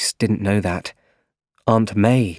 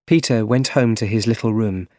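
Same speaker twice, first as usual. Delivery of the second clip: ordinary delivery, nothing marked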